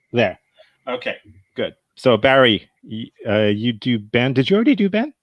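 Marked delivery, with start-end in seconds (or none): none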